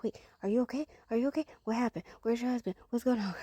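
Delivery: retold panic at a crying friend; panicked questions. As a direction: fire off the questions in alarm. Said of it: "And so I'm panicking".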